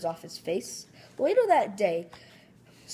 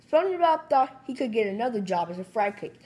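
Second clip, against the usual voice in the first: usual speaking voice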